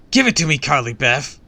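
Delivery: high, loud villain's demand; shouted demand. A narrator's reading. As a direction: yell at the girl to hand it over.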